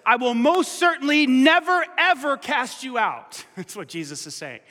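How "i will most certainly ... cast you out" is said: "never" high and loud, "ever cast you out" slow; emphatic promise. Shown as a demonstration. Pastor rendering the Greek for the church, emphatic and slow. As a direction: lean hard on never, ever. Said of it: "You could say it like this".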